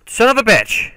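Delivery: high loud scream after a gasp; gasp, then scream the curse; startled shriek. Real, not acted. Gamer jump-scared by a mob, shrieking.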